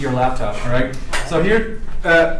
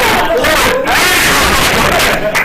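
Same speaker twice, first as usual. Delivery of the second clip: high, loud burst of delighted laughing; a shout of laughter; 9 semitones higher, 5 dB louder than usual. Real, not acted. Laughter after Daniel's result.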